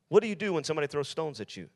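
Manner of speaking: normal talking voice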